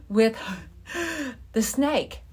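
breathless, panting words; out of breath. Retold or imitated. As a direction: gasp it out after running home. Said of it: "all I could literally say out of breath".

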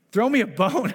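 high, loud, whiny mock prayer; a hammed-up plea for scraps; mock begging plea. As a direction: beg God like a needy believer. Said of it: "if you go".

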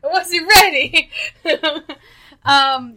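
high laughing, caught off guard; flustered laughing. Real, not acted. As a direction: laugh it out, not ready.

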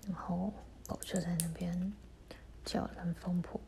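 hushed whisper so mom can sleep. Real, not acted. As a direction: keep it to a breathy whisper. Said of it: "所以我讲话要很小声".

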